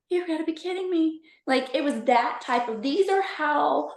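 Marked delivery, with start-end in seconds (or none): 0.1-1.2 mock disbelief. Retold disbelief at coincidence.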